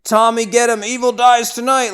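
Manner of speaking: high, loud rallying cry; screamed command. Retold or imitated. Laurie urging Tommy and the mob, loud high cry. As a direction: rouse the mob to hunt him. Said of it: "Laurie screams".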